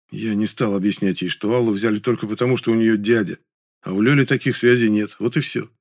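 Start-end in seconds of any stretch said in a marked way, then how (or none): none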